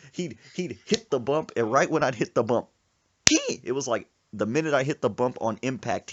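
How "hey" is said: sudden very high, loud squeal; frightened yelp. Retold or imitated. Passenger hit by a bump, high yelp. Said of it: "he'd make that".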